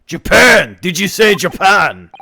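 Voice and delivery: loud, high Scottish disbelief; outraged Scottish governor. Acted. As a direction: shout it back in Scottish outrage. Voices the governor.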